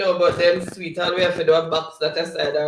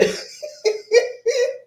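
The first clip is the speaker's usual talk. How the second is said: high rising laugh, louder; real laughter; 4 dB louder than usual. Real, not acted. Host reading Delco David in chat laughs high and rising. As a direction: squeal with laughter at the joke. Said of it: "I'm laughing".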